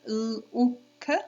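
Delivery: "L, U, K" as three isolated sounds, held l, short oo, kuh; sounding out. Shown as a demonstration. Teacher counting sounds with children, segmented "L, U, K". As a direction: separate each phoneme in "L, U, K". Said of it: "How many sounds?".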